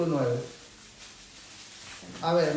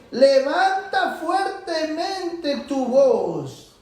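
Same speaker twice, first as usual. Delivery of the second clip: high, very slow proclamation; raised preaching voice; 6 semitones higher, much slower than usual. Shown as a demonstration. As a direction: stretch it out and lift the voice high. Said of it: "Este es el modo justo de predicar".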